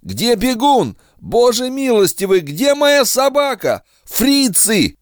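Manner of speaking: frantic high battlefield cry; panicked shout. Acted. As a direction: shout in terror. Shell-shocked flashback, panicked shouting.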